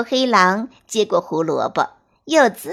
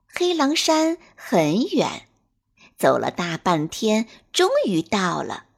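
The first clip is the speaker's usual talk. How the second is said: plain reading voice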